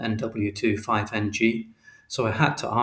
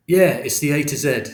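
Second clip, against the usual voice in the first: louder, higher shopkeeper, fed up; gruff shopkeeper; 3 semitones higher, 6 dB louder than usual. A narrator's reading. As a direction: answer at last, fed up.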